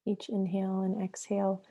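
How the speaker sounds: unhurried meditation guide with long pauses between phrases; guided meditation. Acted. Yoga therapist guiding listeners into breath and flow, guided meditation. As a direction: lead them into the breath, leaving long silences.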